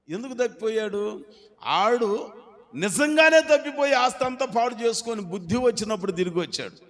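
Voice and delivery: loud, high fervent preaching; preaching shout. Acted. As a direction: drive the point home loudly. Preaching at full force. (గట్టిగా ప్రసంగం)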